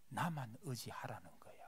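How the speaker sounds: 숨소리로 속삭이는 하나님의 음성; 조용히 속삭이듯 전하는 말씀; God's whisper. Acted. Quoting God's gentle words. (하나님이 약한 자에게 속삭이듯)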